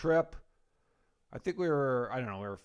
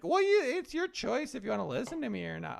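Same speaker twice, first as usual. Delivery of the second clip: innocent wife; 10 semitones higher than usual. Retold or imitated. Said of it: "she thought she was innocent".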